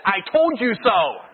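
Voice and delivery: slow, laughing I-told-you-so; gloating conscience. Retold or imitated. Conscience to the speeding driver, laughing gloat. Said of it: "And your conscience said".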